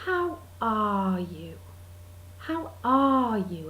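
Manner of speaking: slow "How ARE you", long weighted ARE; stress on ARE. Retold or imitated. Greeting someone after an absence, slow stress on ARE. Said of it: "that emphasis in the middle".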